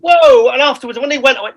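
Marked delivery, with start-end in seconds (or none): 0.0-0.5 loud, high re-enacted whoa; startled reaction. Startled by Nathan's American accent at the hotel, loud mock surprise. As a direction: act out being taken aback, loudly.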